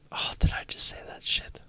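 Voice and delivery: breathy whispered aside to himself; an unvoiced whisper, caught out. Real, not acted. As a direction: hush it, embarrassed at what slipped out. Aside to self.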